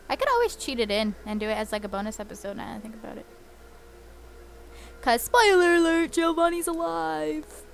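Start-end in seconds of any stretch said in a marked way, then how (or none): 5.0-7.6 high, slow, playful announcement; mock-dramatic spoiler; dramatic reveal. Real, not acted.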